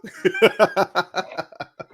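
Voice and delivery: long giggle; helpless laughter; laughing fit. Real, not acted. Laughs at "are you.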